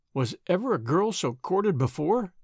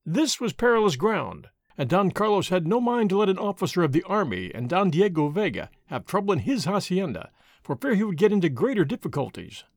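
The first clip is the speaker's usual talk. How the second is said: ordinary delivery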